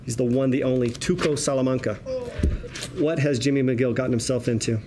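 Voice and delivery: ordinary delivery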